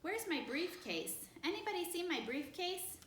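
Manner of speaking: father hunting upstairs for his briefcase; calling from upstairs. A narrator's reading. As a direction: call out to the whole house. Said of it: "called from upstairs".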